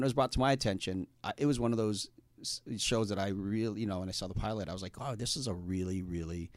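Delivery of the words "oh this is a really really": usual speaking voice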